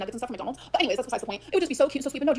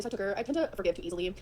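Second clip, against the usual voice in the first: ordinary delivery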